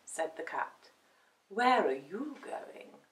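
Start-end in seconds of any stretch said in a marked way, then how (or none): 1.5-3.0 slow, soft cat voice; lightly voiced, unhurried cat; aloof cat. A narrator's reading. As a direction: ask slowly, with cool curiosity. Cat questions puppy.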